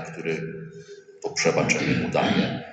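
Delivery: ordinary delivery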